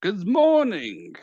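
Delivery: high, loud, drawn-out hello; booming chef greeting. Acted. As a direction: belt the good morning slowly.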